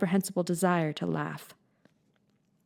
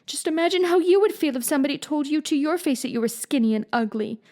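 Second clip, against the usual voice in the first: put-on high girl's voice, mildly tearful; tearful plea; 8 semitones higher than usual. A narrator's reading. Anne pleading with Marilla, a touch tearful. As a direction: plead with her, lightly teary. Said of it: "pleaded Anne tearfully".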